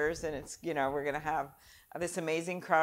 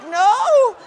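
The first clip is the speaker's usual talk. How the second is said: mock shocked no; 20 dB louder than usual. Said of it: "we're like".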